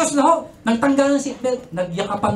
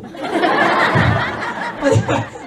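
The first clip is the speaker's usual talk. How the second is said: breathy laugh at his own joke; real laugh at his own punchline; laughter; 5 semitones higher than usual. Real, not acted. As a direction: laugh it off, airy and loose.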